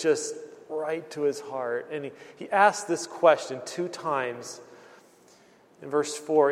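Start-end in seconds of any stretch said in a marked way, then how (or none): none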